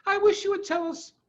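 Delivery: put-on high, eager child asking; a young fox pleading to know; lightly voiced small child; child's voice. A narrator's reading. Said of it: "said one of the children".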